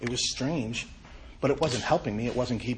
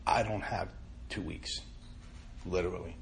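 soft, breaking plea, much softer at the end; cracked voice; 5 semitones lower, 7 dB quieter than usual. Retold or imitated. Begging the sponsor for help in a cracked, soft voice. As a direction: let the voice crack on two weeks. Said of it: "that same cracked voice".

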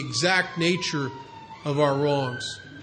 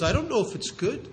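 put-on light Yiddish accent; 5 semitones higher, 6 dB quieter than usual. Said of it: "a little bit of a Yiddish accent".

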